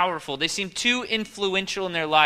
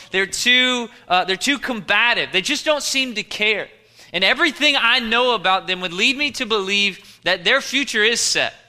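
raised, louder preacher's voice; forceful preaching; 5 semitones higher, 7 dB louder than usual. Real, not acted. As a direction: preach it hard, pitched up and loud.

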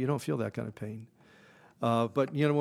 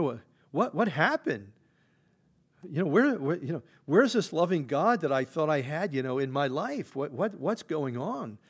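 a lightly voiced, higher, bewildered Job; preacher voicing Job's bewildered lament, higher and pleading; imagined Job lamenting; 4 semitones higher than usual. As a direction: plead like a lost man asking where God went. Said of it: "I imagine Job".

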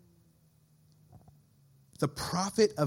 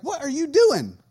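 higher, louder incredulous mock rant; hammed-up disbelief at their excuse; mock exasperation; 6 semitones higher, 4 dB louder, faster than usual. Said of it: "It's like".

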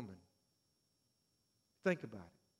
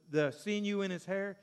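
normal talking voice

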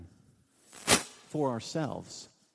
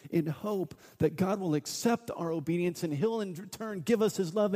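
normal talking voice

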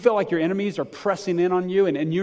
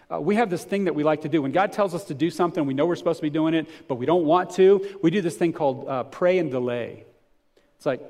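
normal talking voice

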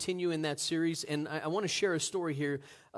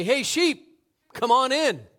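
higher, loud call to the sheep; 8 semitones higher, 9 dB louder than usual. Retold or imitated. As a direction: call them in across a field. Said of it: "I just say".

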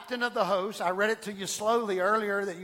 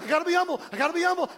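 higher, louder strained self-urging; anxious false humility; 8 semitones higher, 4 dB louder, much faster than usual. Retold or imitated. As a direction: push yourself to be humble, strained and high. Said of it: "false humility".

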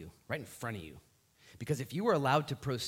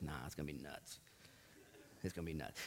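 much lower, softer, deadpan God voice; deep voice of God; 11 semitones lower, 15 dB quieter, faster than usual. Retold or imitated. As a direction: drop into a deep, dry divine rumble. God's voice acted.

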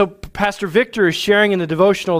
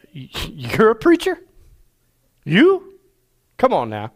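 rising high disbelief on "You?"; doubting gang buddy scoffs at the idea; incredulous; 4 semitones higher, slower than usual. Retold or imitated. Gang friend mocking [name] becoming a preacher, incredulous. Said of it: "He said".

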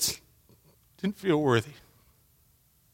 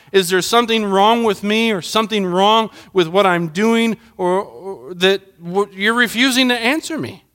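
raised, urgent questions to God; pleading prayer; 7 semitones higher, 3 dB louder than usual. Retold or imitated. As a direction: plead with God, pressing each question higher. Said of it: "and I was like".

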